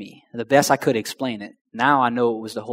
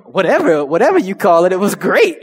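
loud, high, joyful whatever; louder happy brush-off; elated; 6 semitones higher, 8 dB louder than usual. Retold or imitated. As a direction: shrug off the name, thrilled. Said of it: "And I'm thinking".

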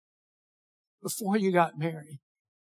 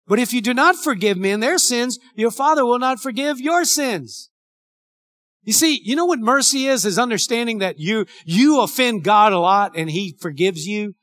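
louder, higher preaching voice quoting scripture; fervent pulpit delivery, loud; full-force preaching; 5 semitones higher, 8 dB louder than usual. Acted. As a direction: drive the verse home to the congregation.

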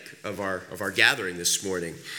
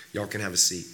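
usual speaking voice

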